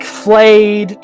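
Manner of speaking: normal talking voice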